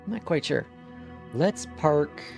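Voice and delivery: normal talking voice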